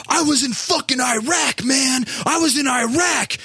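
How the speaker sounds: high, strained mock yell. Shirtless guy yelling at the guard from afar, high mock shout. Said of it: "yelling, going".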